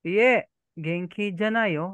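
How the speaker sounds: "Iye, genki janai yo" slowly drawn out; slow teaching model. Shown as a demonstration. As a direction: model the phrase slowly for a learner. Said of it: "So, pag hindi ka okay".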